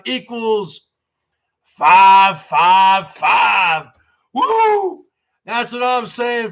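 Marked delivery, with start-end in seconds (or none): 1.6-3.8 slow, loud, drawn-out chant; excited shout. Real, not acted. Hyping the big tip. 4.3-5.0 excited whoop. Real, not acted. Cheering the tip.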